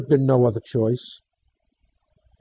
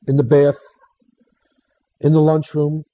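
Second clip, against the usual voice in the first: usual speaking voice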